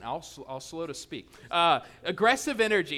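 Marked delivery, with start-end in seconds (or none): 1.5-3.0 mock aggressive shout. Joking about aggressive energy.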